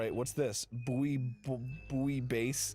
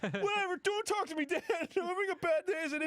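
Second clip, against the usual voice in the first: put-on very high whiny teen voice, fast at the end; a moody kid brushing off his dad, hammed up; sulky teen. Son whining at his dad to leave him alone, in a high sulky voice.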